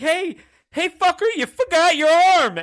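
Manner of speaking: very high, loud laughing yell; heckling yell. Retold or imitated. Fans heckling a pitcher from the front row, yelling. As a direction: shout it at the pitcher. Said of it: "I'd be like".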